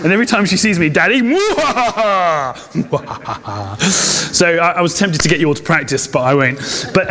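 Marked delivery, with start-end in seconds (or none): 0.9-3.8 playful slow villain laugh, fading away; mock-villain cackle at daddy; evil cackle. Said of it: "the evil voice".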